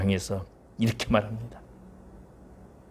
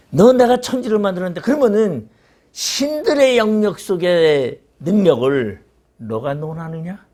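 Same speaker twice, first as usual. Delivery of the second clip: God rebuking; 5 semitones higher, 3 dB louder than usual. Acted. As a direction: thunder down at a mere man. Preacher voices God rebuking Job.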